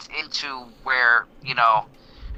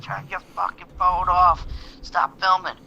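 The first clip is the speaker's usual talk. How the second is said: mild mock yell, pitched up; retold yell; 4 semitones higher than usual. Stranger yelling at him for filming, mock yell. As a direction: imitate someone yelling without really shouting. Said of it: "I hear this somebody yell in the background".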